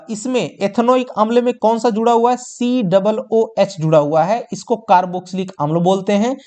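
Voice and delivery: ordinary talk, nothing marked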